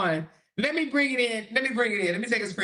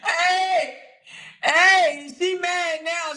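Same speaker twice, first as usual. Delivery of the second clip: louder, higher calling out; excited; 7 semitones higher, 5 dB louder, much slower than usual. Real, not acted. Streamer calling out chat, excited and amused. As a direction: call it out, amused.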